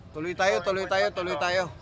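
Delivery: ordinary talk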